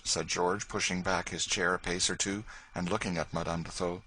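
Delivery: plain reading voice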